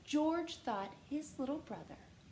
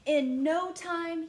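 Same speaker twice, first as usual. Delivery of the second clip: excited; 6 semitones higher, 9 dB louder, slower than usual. A narrator's reading. Story building excitement.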